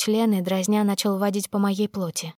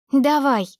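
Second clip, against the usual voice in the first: urgent demand; 5 semitones higher, 4 dB louder than usual. A narrator's reading. Impatient demand in love scene.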